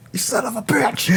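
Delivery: high-pitched playful cursing; comic high outrage; mock outrage. Mock outrage at co-host's joke.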